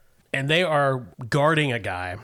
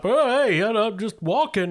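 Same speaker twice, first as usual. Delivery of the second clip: high, placating neighbor voice; backing-off neighbor; 9 semitones higher, 4 dB louder than usual. Acted. Neighbor backing away from an armed guard, high and placating. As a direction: back off in a raised, harmless voice. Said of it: "He backs up".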